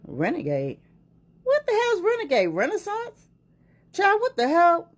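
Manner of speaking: high incredulous what the hell; baffled question. Real, not acted. Puzzling over the word renegade, incredulous. Confused at song name.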